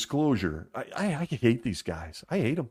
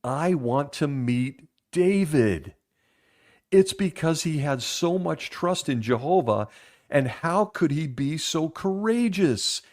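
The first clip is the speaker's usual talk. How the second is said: slow, higher, lightly voiced small boy; slow, higher, earnest little boy; young boy voice; 5 semitones higher, slower than usual. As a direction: imitate a small boy asking in earnest wonder. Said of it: "one young boy saying the following".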